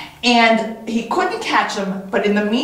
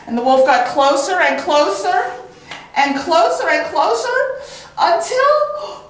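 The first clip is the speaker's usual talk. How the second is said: rising higher and higher with each closer; building suspense; 9 semitones higher than usual. A narrator's reading. Storyteller building suspense, pitch climbing. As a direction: build tension up to a high peak.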